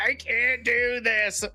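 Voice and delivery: high, slow, drawn-out whine; mock-whining that you can't go on; exhausted whine. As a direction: moan it like you're done for. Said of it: "everybody's like".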